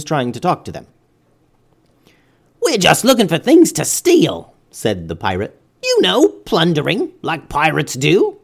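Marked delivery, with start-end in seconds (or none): 2.6-4.5 high, raised pirate voice; gruff-natured pirate, pitched up. A narrator's reading. Pirate answers the robot. 5.8-8.4 high pirate voice, slowing at the end; easy pirate shrug. A narrator's reading. Pirate answers the robot.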